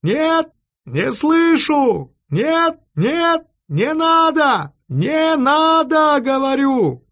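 high, drawn-out telephone yell; shouting. A narrator's reading. Officer yelling into a telephone receiver, high drawn-out shout. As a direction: holler down a bad line, stretching the vowels. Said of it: "и закричал ещё громче".

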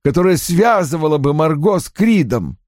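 put-on high-pitched, emphatic older father; Roy's agitated character voice. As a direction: play an indignant father, pitched well up.

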